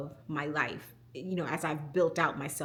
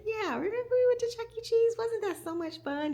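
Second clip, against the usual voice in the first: put-on high, fast, sing-song cheer for a little kid; sweet coaxing mom voice. Mother cheers up her crying daughter about Chuck E. Cheese, sing-song. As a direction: brighten up a sad child with excitement.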